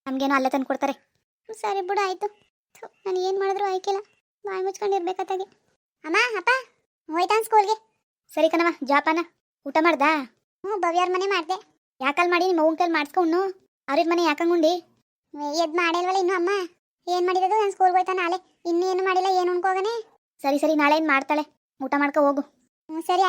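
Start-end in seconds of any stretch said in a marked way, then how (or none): 1.5-5.5 sulky daughter. Acted. Daughter sulks after scolding. 6.0-19.9 put-on small child voice. Child talks to mother.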